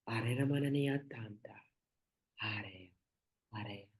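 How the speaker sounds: soft chanted whisper, slowing and fading out; whispered blessing. Real, not acted. Host whispers a blessing over the listeners, fading away. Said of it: "I'm just gonna speak a little blessing".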